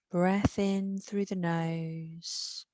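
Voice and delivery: usual speaking voice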